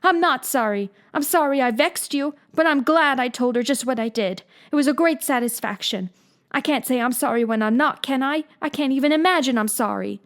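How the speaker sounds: put-on high girl's voice, insistent; Anne, stubborn girl. A narrator's reading. Anne refusing to apologize to Marilla, stubborn. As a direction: she won't budge.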